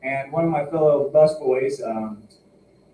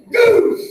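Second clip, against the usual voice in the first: much higher, loud goofy cry; cartoon yelp; 9 dB louder than usual. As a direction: squeal it like a cartoon. Cartoon cry after bazooka.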